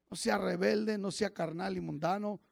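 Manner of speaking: ordinary delivery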